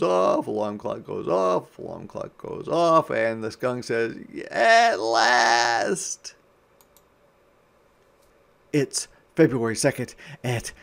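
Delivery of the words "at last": high, loud cry of relief; triumphant shout. Acted. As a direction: shout it out at long last. Skunk shouts at alarm.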